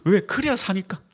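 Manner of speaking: usual speaking voice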